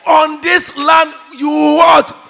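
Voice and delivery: loud, high, slow call for a response; shouted call-out. Real, not acted. Preacher prompting the congregation to answer, slow high shout. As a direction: shout it and stretch the last word.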